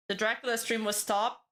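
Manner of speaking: ordinary talk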